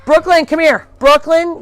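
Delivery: loud, high call-out; calling a pet. Real, not acted. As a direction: holler for the animal to come. Calling an animal over.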